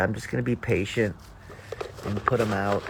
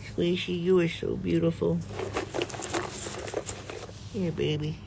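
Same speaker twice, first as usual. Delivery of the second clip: sweet nothings; 5 semitones higher, 4 dB quieter than usual. Real, not acted. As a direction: coo softly to a pet. Cooing to chipmunk.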